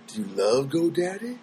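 mock cult-like questioner; creepy survey voice. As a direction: make the survey sound creepy.

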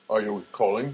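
lightly imitated automated phone voice; robot guy. Phone robot questioning a caller, lightly robotic. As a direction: play the phone system's robot. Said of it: "the robot guy goes".

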